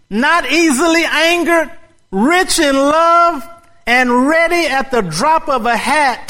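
high, loud furious complaint; yelling at God. Acted. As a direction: hurl it at God in anger. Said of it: "He yelled at God".

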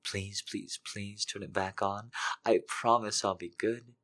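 soft, breathy, pleading whisper-voice; soft desperate begging. Acted. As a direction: plead softly, hungry and desperate. Character begs in hushed voice.

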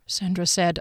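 ordinary delivery, nothing marked

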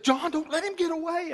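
high, loud wife voice pleading; urgent wife. Retold or imitated. Wife urging John not to let the preacher leave, high and loud. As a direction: voice the wife begging him not to miss the chance.

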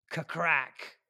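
loud mimicked thunder crack; thunder crack sound. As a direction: bellow a thunder clap.

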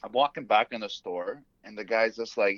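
ordinary talk, nothing marked

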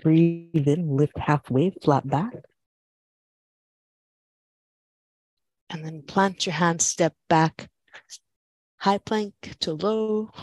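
calm, steady class cues; yoga instructor voice. Acted. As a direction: guide the flow evenly.

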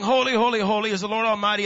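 loud call, settling as it goes. Acted. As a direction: call it out like a proclamation. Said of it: "They're calling".

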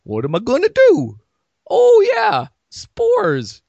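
high-pitched mock dilemma, then loud sudden realization; hammed-up tree having a bright idea; pondering tree. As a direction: wail the question, then remember with a rising cry. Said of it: "Just been sitting there looking around going".

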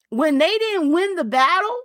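ordinary talk

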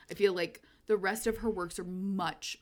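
ordinary talk, nothing marked

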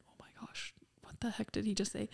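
stunned whisper under the breath; hushed disbelief. Retold or imitated. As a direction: imitate a friend aghast, barely audible. Friend's stunned reaction, voiced.

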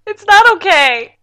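high, loud wail with a laugh in it; tearful protest. Real, not acted. Listener refusing comfort over the ending, tearful protest. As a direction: wail it like you're half crying.

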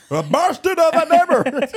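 Irish-Indian accent imitation. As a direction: parrot him in a hammed-up Irish-Indian accent. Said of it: "mix of Irish and Indian accent".

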